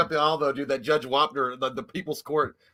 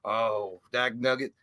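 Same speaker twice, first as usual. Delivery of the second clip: folksy judge; 6 semitones lower, 5 dB quieter, much slower than usual. Retold or imitated. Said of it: "he got on the computer".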